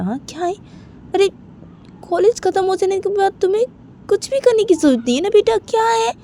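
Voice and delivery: lightly acted father, questioning and coaxing; insistent father. Father offering his daughter the company, insistent and coaxing. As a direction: lively pitch swings, pressing with questions.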